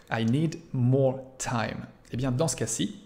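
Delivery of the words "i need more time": slowed-down repeat, each word spaced. Shown as a demonstration. Teacher slowly repeats the example for students. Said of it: "teacher repeats example slowly".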